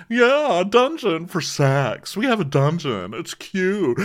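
mock giddy enthusiasm; gushing imitation. Imitating Noella's dungeon boast.

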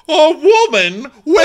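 very high, loud mock shriek; outraged disbelief. Councillors hear a woman may inherit, outraged. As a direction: shriek it as if scandalised.

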